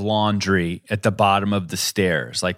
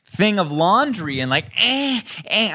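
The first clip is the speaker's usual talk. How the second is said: mock harumphing; 9 semitones higher than usual. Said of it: "she's harumphing".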